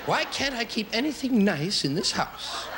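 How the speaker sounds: high, slow, put-upon mom whining; exasperated parent. Retold or imitated. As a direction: whine it like a fed-up mother hunting her things. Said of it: "heard this phrase in your house".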